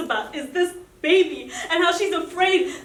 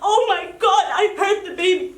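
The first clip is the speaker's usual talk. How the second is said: imitating a hurt woman's higher, louder panic; panicked pleading; 6 semitones higher, 7 dB louder than usual. Injured pregnant woman crying out to him, panicked. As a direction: voice her frantic fear for the baby. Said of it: "she's looking at me and saying".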